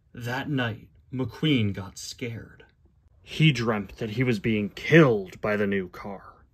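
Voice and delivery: slow, grave storybook reading with long pauses; measured, dramatic story narrator; dramatic storybook narration. A narrator's reading. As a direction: narrate it like a solemn tale, unhurried. Reads the storybook aloud.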